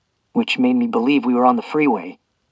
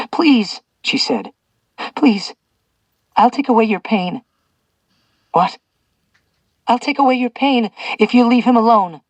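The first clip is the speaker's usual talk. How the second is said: put-on high earnest pleading girl voice; lightly voiced young woman pleading; 10 semitones higher than usual. A narrator's reading. Young woman begs for captive.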